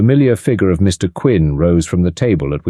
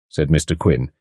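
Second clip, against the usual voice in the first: normal talking voice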